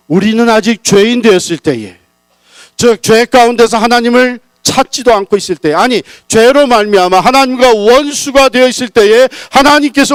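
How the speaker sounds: high, loud fervent preaching; soaring, loud climax of the sermon; full-force preaching. Real, not acted. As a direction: lift them up, preach it out loud.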